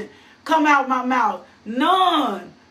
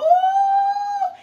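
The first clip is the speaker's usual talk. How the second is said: sudden high falsetto ooh; high whoop. Real, not acted. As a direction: let out a joyful high whoop. Preacher exclaims mid-scripture.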